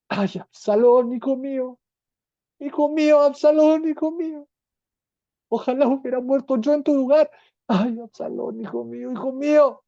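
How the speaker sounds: keen for him like a broken father; weeping lament. A narrator's reading. King David mourning his son Absalón, weeping lament. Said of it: "lloraba y decía".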